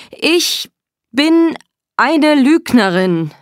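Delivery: high, loud, drawn-out cry; forced loud confession. A narrator's reading. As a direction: cry it out word by word under orders. (verzweifelt laut gerufen)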